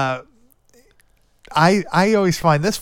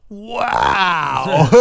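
drawn-out higher mock wow; imitating Mike; 4 semitones higher, 3 dB louder than usual. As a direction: stretch the word out in an exaggerated copy of the kid. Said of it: "And then Mike also goes".

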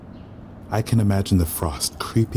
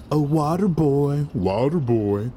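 higher, mocking echo; bitter mimicry; 8 semitones higher, 4 dB louder than usual. Waiter alone outside mocking the customer's call, bitter. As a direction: sneer the words back.

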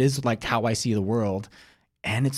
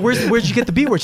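higher, louder, disbelieving question; a dad who can't believe his ears; exasperated dad; 12 semitones higher, 9 dB louder than usual. Retold or imitated. Dad questioning daughter again.

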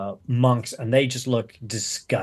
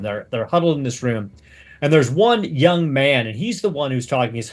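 ordinary delivery

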